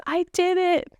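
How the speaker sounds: sky-high thrilled exclamation; triumphant. Retold or imitated. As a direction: relive the moment of triumph. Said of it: "the first thing I said was".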